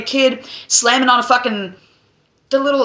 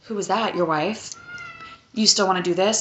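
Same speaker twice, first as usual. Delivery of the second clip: much softer, low-key question; soft quoted question; 3 semitones lower, 7 dB quieter than usual. Retold or imitated. Sex worker softly asks the doctor about his wife. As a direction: ask it quietly, half teasing.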